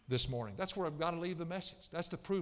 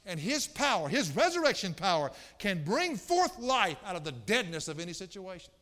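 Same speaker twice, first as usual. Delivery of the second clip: higher, driving sermon delivery with hard stresses; emphatic preaching; 5 semitones higher, 6 dB louder than usual. Real, not acted. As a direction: punch each phrase from the pulpit. Preaches at full force.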